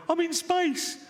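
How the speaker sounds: high-pitched, hammed-up outburst; mock exclaiming. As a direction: cry it out high in comic exasperation. Mocking space tourist, then exasperated retort.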